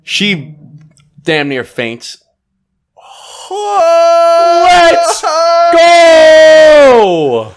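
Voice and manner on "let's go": big long high cheer; real excited yell; celebratory shout. Real, not acted. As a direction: whoop it out for the groom. Celebrating an engagement toast.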